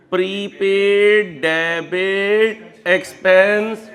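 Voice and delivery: very slow, drawn-out words for the class; slow dictation. Shown as a demonstration. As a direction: dictate each word at a crawl. Teacher spells out the ledger entry. (धीरे-धीरे, खींचकर लिखवाते हुए)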